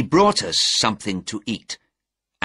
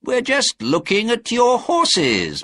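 high, innocent smiling reply; 13 semitones higher, 4 dB louder than usual. A narrator's reading. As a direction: answer an accuser with a smile. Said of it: "said Holmes, smiling".

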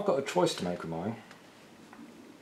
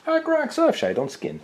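high slow teasing; 3 dB louder than usual. Real, not acted.

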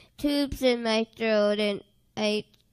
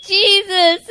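tearful call; 5 semitones higher, 7 dB louder than usual. Retold or imitated. Crying out to Jesus.

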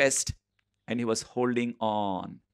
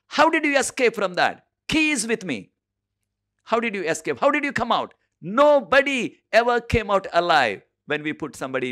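angry demand; 8 semitones higher, 5 dB louder than usual. Retold or imitated. King demands how he escaped.

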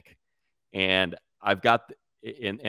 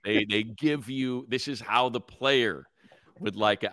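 ordinary delivery

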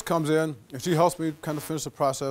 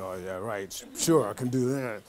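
much lower and softer, sarcastic aside; doubting inner voice; 6 semitones lower, 5 dB quieter than usual. Retold or imitated. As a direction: mutter it to yourself, unsure. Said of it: "It's like".

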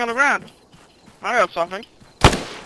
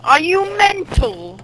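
higher, slow mock British accent; 8 semitones higher, much slower than usual. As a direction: squeak "Are you mental?" slowly in a mock British accent. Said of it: "That's British".

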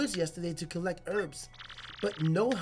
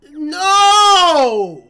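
anguished shout; 10 semitones higher, 18 dB louder than usual. Real, not acted. Hungry children scene.

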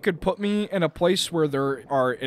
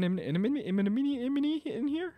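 soft voice climbing ever higher; tongue-tied squeak; 8 semitones higher, 9 dB quieter than usual. Real, not acted. Player stumbling over the word enemy, tongue-tied squeak. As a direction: trip over the word, sliding up in pitch.

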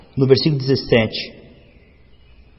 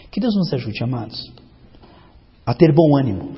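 ordinary delivery

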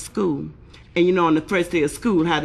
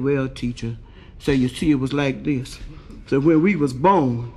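heavy voice; 2 semitones lower than usual. Acted. Triplet explaining himself to the teacher, heavy voice. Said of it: "the one with the heavy voice".

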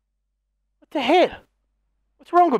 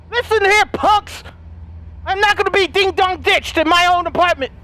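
mock tough; 6 semitones higher than usual. Real, not acted. Gamer scolding unseen door knockers, mock tough. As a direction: puff up and scold the punks.

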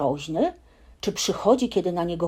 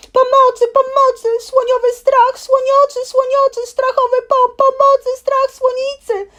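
much higher, louder panicked yelling, words tumbling; screaming at the top of his lungs; 10 dB louder than usual. Acted. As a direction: yell everything out while running away in terror. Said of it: "krzycząc na całe gardło".

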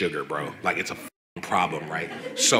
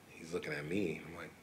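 ordinary talk

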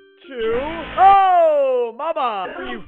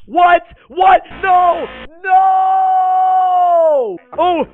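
shocked shouting; 8 semitones higher, 10 dB louder, much slower than usual. Real, not acted. Jump scare.

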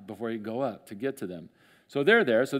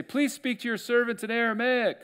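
calling out; 11 semitones higher than usual. Retold or imitated. Leaders pleading to envoy.

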